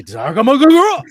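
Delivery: very high, very loud Japanese shout; shouted mock Japanese. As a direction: belt the Japanese words out, hammed-up. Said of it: "you have to greatly crescendo in volume".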